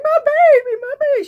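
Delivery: high, loud, desperate wail; frantic yelling. Retold or imitated. Woman refusing to answer the cops, still wailing high and loud. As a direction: keep crying out for the baby. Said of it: "And she's like".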